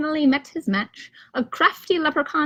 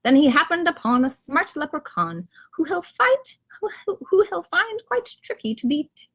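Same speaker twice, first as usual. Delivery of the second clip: ordinary delivery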